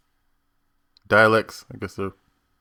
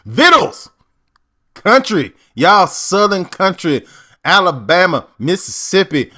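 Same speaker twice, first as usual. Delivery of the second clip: put-on Mississippi country accent; 8 semitones higher, 4 dB louder than usual. Host showing the Southern parallel, exaggerated Mississippi accent. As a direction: holler it like old-time rural Mississippi. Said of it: "if you're from Mississippi".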